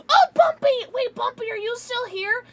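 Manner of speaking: high-pitched burst of sudden recognition; excited calling out. Real, not acted. Streamer suddenly remembering a viewer, excited calling out. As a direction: call out as if just spotting a friend.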